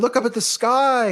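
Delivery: high mock wonder, up stressed; mock amazement. Onlookers point at the launch, high mock wonder. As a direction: gush like a dazzled onlooker. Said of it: "and everybody goes".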